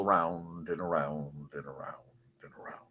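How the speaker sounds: low, dwindling repeats down to a whisper; fading echo. Pretend. Host slips in an obscure reference, fading echo. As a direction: trail each around further away.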